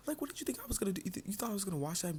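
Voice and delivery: high, fast, incredulous scolding; indignant rant. Retold or imitated. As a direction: tear into him, disbelieving. Ranting about his betrayal.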